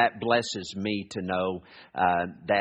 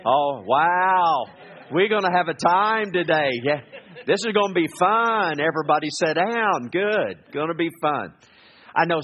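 loud, high, bursting with joy; excited; 8 semitones higher, 5 dB louder than usual. Real, not acted. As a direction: whoop it up, thrilled to start. Excited outburst after the prayer.

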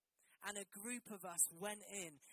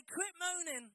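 higher, louder call to the room; loud instruction; 10 semitones higher, 7 dB louder than usual. Shown as a demonstration. Preacher telling church to say it to neighbour, loud call. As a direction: fire it at the congregation. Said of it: "Turn to your neighbor and say".

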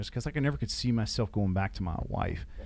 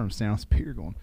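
normal talking voice

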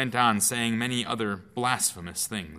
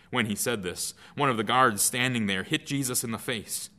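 ordinary talk, nothing marked

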